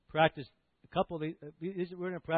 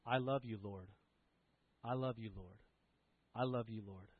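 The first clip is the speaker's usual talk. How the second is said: quiet breath prayer; 3 semitones lower, 7 dB quieter than usual. Shown as a demonstration. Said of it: "or a phrase like".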